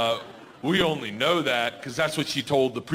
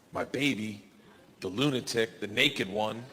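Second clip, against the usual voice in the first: ordinary delivery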